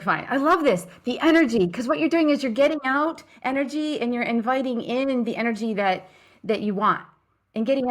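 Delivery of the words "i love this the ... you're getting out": loud, high burst of delight; excited. Real, not acted. Host reacting to the shake-out demo, loud and delighted. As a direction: cheer her on, thrilled.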